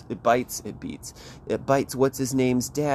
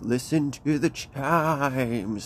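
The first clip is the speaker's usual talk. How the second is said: higher, long drawn-out mock ghost wail; ghostly radio voice; 4 semitones higher, much slower than usual. Ghosts calling to the kids over the radio, eerily drawn out. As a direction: stretch it into a spooky ghost call. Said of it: "the ghosts on the, the radio".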